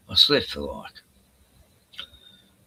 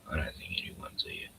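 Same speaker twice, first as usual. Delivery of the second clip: whisper; 14 dB quieter than usual. Real, not acted. Aside to empty stream.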